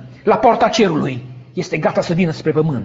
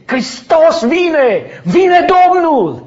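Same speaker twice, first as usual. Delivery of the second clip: higher, louder, slow joyful yell; ecstatic shout; 10 semitones higher, 5 dB louder, much slower than usual. Retold or imitated. Dreamer yelling to the sky as Christ comes, full shout. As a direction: cry it out with all your lungs. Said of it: "am strigat și am urlat din toți plămânii".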